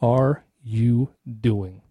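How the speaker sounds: low, slow and grave; voice of the Holy Spirit. Retold or imitated. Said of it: "the Holy Spirit said to me".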